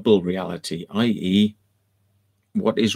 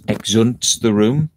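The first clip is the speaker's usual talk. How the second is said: ordinary delivery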